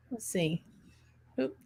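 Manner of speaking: ordinary delivery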